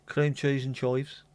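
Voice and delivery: slow Brummie Cream cheese and chives with chives as choives; drawn-out Birmingham vowels; Brummie accent. Shown as a demonstration. Reading crisp flavours from the pub, slow Brummie accent. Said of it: "always sound better in a Brummie accent".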